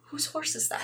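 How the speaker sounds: very soft, hushed Scottish voice; soft Scottish accent. Retold or imitated. Friend repeating a meme at dinner, soft Scottish accent. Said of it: "in a very soft Scottish accent".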